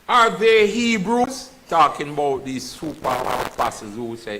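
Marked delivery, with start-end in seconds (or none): 0.1-1.5 rhetorical challenge. Acted. Paul's rhetorical challenge read aloud.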